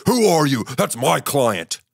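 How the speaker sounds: indignant manager put-on voice; hammed-up territorial challenge as the manager; mock yelling manager. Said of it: "You don't have to yell at anybody".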